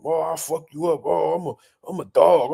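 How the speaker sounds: lightly voiced mocking brag; a hint of swaggering trash talk; mock tough-guy boast. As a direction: imitate a cocky fighter, half-serious.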